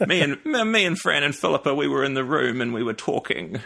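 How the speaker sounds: put-on director voice, breaking into amusement; Peter Jackson impression. Guest imitates a film director telling an anecdote, playful mimicry. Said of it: "Peter Jackson's voice, it would be".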